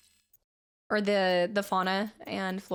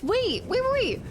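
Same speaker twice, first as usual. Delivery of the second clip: high, loud excited wait; 13 semitones higher, 4 dB louder than usual. Real, not acted. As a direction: burst out with excitement. Excited discovery.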